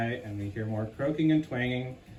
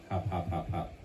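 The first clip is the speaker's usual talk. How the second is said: soft hopping sounds; 7 semitones lower, 6 dB quieter than usual. Acted. Narrator voicing hopping sound effect.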